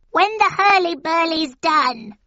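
high, loud witch voice. A narrator's reading. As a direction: call it out like a hag. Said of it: "added the second".

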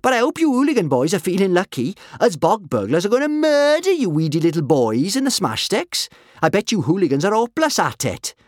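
put-on high, loud, lively cheeky girl; cheerful taunting. A narrator's reading. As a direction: taunt the opposing team gleefully, pitched high.

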